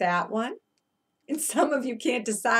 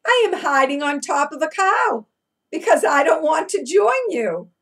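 a hint of a cheeky turkey, a bit louder; playful turkey voice; 6 semitones higher, 7 dB louder than usual. Turkey teasing the children from the cow, lightly voiced. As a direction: tease the kids as the hiding turkey.

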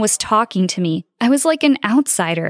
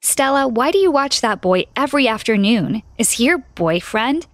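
ordinary delivery, nothing marked

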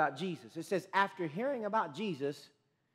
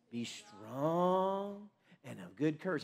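slow, deep, soft voice of God; a hushed divine voice, drawn out; 3 semitones lower, 5 dB quieter, much slower than usual. Retold or imitated. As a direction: intone it slowly like a voice from above. God's answer, heard in prayer.

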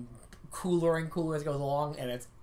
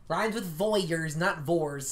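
normal talking voice

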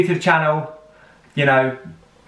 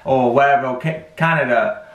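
normal talking voice

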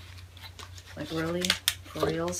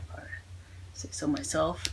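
whispering; 3 semitones lower, 8 dB quieter than usual. Real, not acted. Aside while testing pen.

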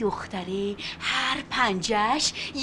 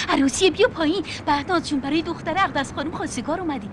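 normal talking voice

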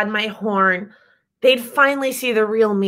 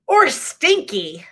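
disgusted; 6 semitones higher, slower than usual. Acted. Sock on her head.

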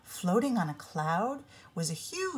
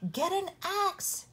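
a mild shout, high and hurried; 12 semitones higher than usual. A narrator's reading. Boy calling to his mother for an axe, high mild shout. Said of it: "Jack shouted to his mother".

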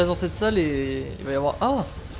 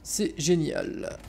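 sarcastic; 7 dB quieter, much slower than usual. Real, not acted. After dying, sarcastic.

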